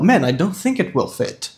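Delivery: "think it will fit" as "thenk et wel fet", run together; connected model with eh-like i in "think it will fit"; corrected pronunciation. Shown as a demonstration. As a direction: relax every i in "think it will fit" toward ê. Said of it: "o I tem som de E".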